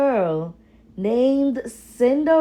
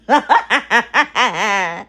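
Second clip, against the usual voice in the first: louder mocking giggle from the stepsisters; mocking sisters' laugh; 4 dB louder than usual. Stepsisters mock Cinderella's hope with a louder giggle. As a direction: laugh at her like spiteful sisters. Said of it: "Her stepsisters laughed".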